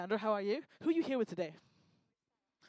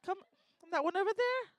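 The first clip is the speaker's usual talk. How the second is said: fussy high voice; 8 semitones higher than usual. Acted. Stammering, high strict voice.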